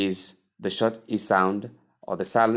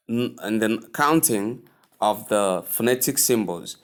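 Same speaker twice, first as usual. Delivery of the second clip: ordinary talk, nothing marked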